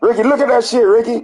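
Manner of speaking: high excited call to Ricky. Real, not acted. Pointing Ricky to the haircut, high excited call. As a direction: call him over squealing.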